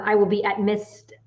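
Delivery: normal talking voice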